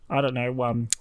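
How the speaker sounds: usual speaking voice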